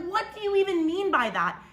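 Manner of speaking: high, disbelieving retort; retold high-pitched disbelief; incredulous. Retold disbelief at sister's remark.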